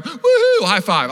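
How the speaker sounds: very high, loud cheer; victory whoop. Retold or imitated. Boy celebrates winning the war with friends, gleeful whoop.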